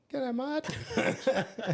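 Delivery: high-pitched, hammed-up Aussie hello; mock cheery greeting. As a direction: pipe up high as the out-of-place tourist. Retelling awkward greeting to staring locals.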